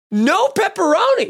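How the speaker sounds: high, indignant complaint; mad homeless man. Retold or imitated. Homeless man griping at the volunteer, high and indignant. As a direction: whine it like a cheated customer. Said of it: "He was mad".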